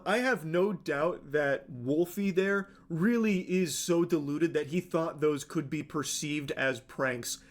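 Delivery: usual speaking voice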